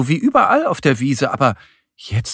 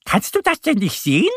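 high, quick duck voice; high rapid little duck question; Annie; 11 semitones higher, faster than usual. A narrator's reading. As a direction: ask it fast and high-pitched. Narrator voices duck Annie asking.

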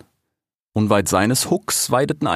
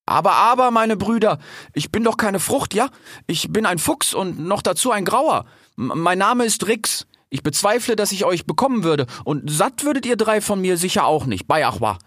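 high, stammering little fox; firm, hiding fear; 10 semitones higher than usual. A narrator's reading. Fox in a tree reasons with hungry cheetahs, high and nervously firm. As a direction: keep a firm front over nervous stumbles. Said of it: "rief Rix mit festem Ton, der seine Angst verschleierte".

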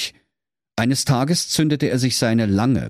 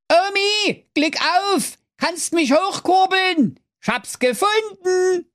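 calling up. A narrator's reading. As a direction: call up to granny at the top of your range. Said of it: "rief nach oben". (hohes Rufen aus dem Brunnen hinauf)

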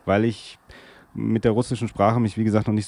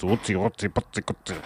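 mock German gibberish; 4 dB quieter than usual. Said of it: "wie man's natürlich vom Klischee her kennt".

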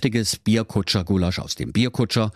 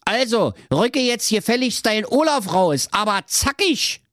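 bossy evil doctor; 12 semitones higher than usual. Acted. Doctor demanding Olaf from Trulla, high bossy squeak. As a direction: demand the baby like a petty cartoon villain.

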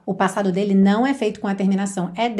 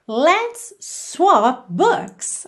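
very slow, drawn-out teaching model, higher; slow model phrase; 5 semitones higher, much slower than usual. Shown as a demonstration. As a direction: spell it out slowly for learners, rising at the end. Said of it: "como é que você diria?". (frase modelo bem devagar, mais aguda)

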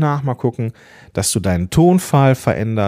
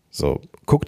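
normal talking voice

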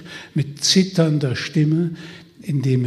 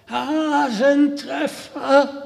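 higher, slow, drawn-out hoarse call; hoarse shout; 12 semitones higher, 3 dB louder, much slower than usual. Acted. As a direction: call the name out the window, hoarse. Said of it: "schrie er mit heiserer Stimme hinaus". (heiser hinausgeschrien)

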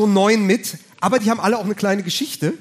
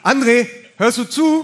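raised, slow call for attention; calling out to a player; 5 semitones higher, 4 dB louder than usual. Retold or imitated. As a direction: call across the dressing room.

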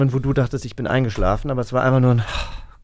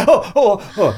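gespielter Schreck; 7 semitones higher than usual. Acted. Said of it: "Dann hab ich mich erschrocken".

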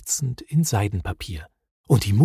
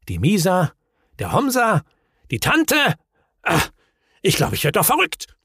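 climbing higher name by name; mounting exasperation; 8 semitones higher than usual. A narrator's reading. Hemul going mad over his list, increasingly frantic. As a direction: build from raised pitch to a frantic, quick outburst.

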